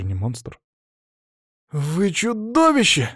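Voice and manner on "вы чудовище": high, drawn-out accusing cry; boy's wail. A narrator's reading. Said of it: "завопил Боря". (протяжный вопль высоким голосом)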